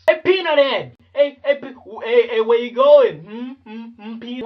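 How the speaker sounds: loud high-pitched heckle; mock taunting shout. Kids mocking a boy with peanut head jokes, taunting shout. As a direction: holler it like a teasing kid. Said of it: "same nuthead jokes".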